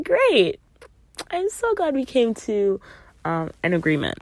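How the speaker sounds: high-pitched mock cheer; sugary, sarcastic retold reply; sarcastic delight. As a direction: feign delight at bad news. Sarcastic reply to being let go.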